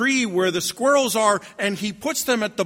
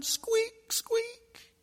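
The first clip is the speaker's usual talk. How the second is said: very high, soft squeak; thin little high-pitched squeaks; tiny mouse squeak; 7 dB quieter than usual. Acted. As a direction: squeak faintly like a baby mouse. Imitates lost baby mouse.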